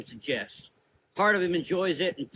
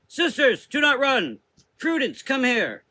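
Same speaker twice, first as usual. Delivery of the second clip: much higher, louder commanding shout; authoritative shout; 12 semitones higher, 7 dB louder than usual. A narrator's reading. Mother Azra halting the fleeing sisters, commanding shout. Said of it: "shouted with authority".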